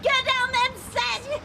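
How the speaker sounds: high-pitched yell up the stairs. Acted. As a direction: bellow them down the stairs. Yelling up the stairs at someone.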